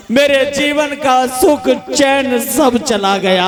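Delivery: slow, drawn-out mock wail; milk the fake grief; mock lament. Husband telling a stranger his joy left on the train, mock lament. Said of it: "रोते जा रहे हैं".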